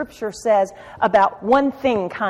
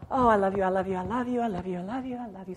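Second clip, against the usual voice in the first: fading to a hush, mock lovesick; swooning parody of shallow worship; mock swooning devotion; 3 semitones lower, 9 dB quieter, much faster than usual. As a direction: parody a gushing lover, softer and softer.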